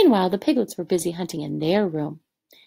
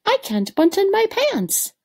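high complaint; 11 semitones higher than usual. Acted. Stuffed pig complains after dinner.

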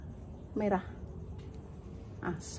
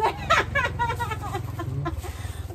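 high burst of laughter; 12 semitones higher than usual. Real, not acted. Laughter at the joke.

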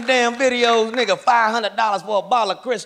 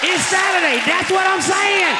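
triumphant shout; 8 semitones higher than usual. Retold or imitated. Excited weekend drinking cry.

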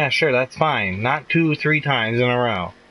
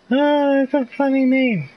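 giggly high voice; 4 dB louder than usual. Real, not acted. Laughing at a silly name.

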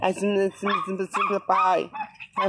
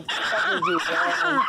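put-on baby talk; 9 semitones higher than usual. Teasing dog in baby voice.

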